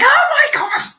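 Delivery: incredulous. Retold or imitated. Vet reacting to owners calling it only a little limp, incredulous. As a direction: sound aghast that they missed it.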